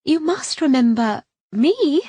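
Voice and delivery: high, loud, slow gushing society lady. A narrator's reading. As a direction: sweep in overbearingly familiar. Gushing society lady greets Callista.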